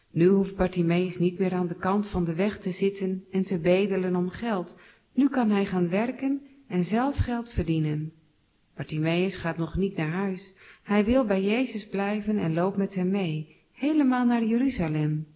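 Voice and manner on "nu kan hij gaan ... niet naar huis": ordinary delivery, nothing marked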